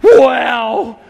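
a son's high, loud shocked "Wow!"; startled shout. Retold or imitated. Son watching dad kick a chair, shocked shout. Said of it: "he just looks at me and goes".

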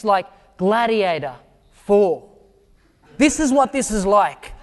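usual speaking voice

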